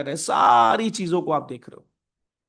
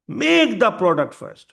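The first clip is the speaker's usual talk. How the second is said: higher, louder emphatic order; bold company command; 4 semitones higher, 6 dB louder, much slower than usual. Acted. Apple orders its people to build the product, bold command. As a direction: lay down the rule forcefully.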